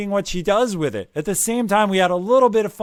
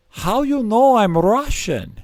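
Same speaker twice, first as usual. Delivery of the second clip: put-on slow, higher-pitched Russian-accented English; 5 semitones higher, 3 dB louder, slower than usual. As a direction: imitate a Russian carpenter's accent, drawn out. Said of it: "I will do one part of that accent".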